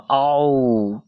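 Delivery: long mock dinosaur roar; drawn-out storybook roar; imitated roar. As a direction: stretch out a pretend howl.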